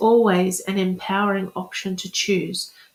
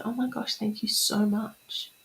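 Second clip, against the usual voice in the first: whispered gratitude; 2 semitones higher, 7 dB quieter than usual. Shown as a demonstration. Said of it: "you say".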